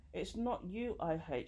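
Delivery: usual speaking voice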